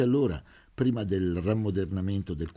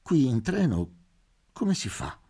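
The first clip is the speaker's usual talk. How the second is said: higher, anxious repeat; doctor's anxious repeat, pitched higher; frightened; 6 semitones higher than usual. A narrator's reading. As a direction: glance nervously down the corridor as you ask. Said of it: "guardando spaventato verso il corridoio".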